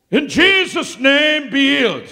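slow, high, loud command; preacher voicing a bold healing command. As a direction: proclaim it slowly with full authority.

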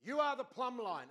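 man in cafe insisting earnestly; insistent prophet. Retold or imitated. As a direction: proclaim it like a zealous prophet. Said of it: "He says".

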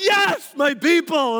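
high, loud victory cry; high shouted cheer; triumphant shout. Retold or imitated. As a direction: celebrate finally being understood. Celebrating dad's approval.

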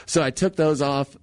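ordinary talk, nothing marked

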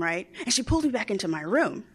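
normal talking voice

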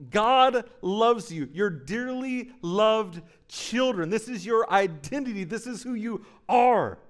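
preaching. Acted. Preacher telling the church who they are, full-voiced. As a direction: proclaim it over them with conviction.

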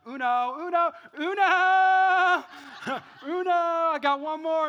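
loud, high, hammed-up triumph; gloating Uno calls. Dad playing Uno with his boys, gloating loudly.

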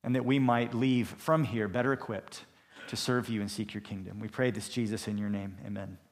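soft, low closing prayer; quiet prayer to close the opening. Acted. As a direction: hush down and pray it reverently. Closing prayer before sermon.

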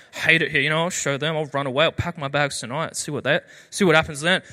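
low but audible mock muttering; teenage grumbling. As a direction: rant to yourself like a classic teenager. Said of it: "I'd go, like, mumble to myself".

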